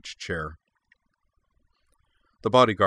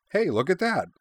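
a bright, much higher hey; 11 semitones higher than usual. A narrator's reading. Mileva gloating over the felled bodyguard, bright and high. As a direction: chirp it out delighted. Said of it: "Mileva remarked brightly".

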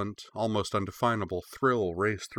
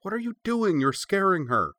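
much higher, louder alarmed protest; girl protesting guards scaring her pup; upset protest; 12 semitones higher, 4 dB louder than usual. A narrator's reading. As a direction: shout at them to back off.

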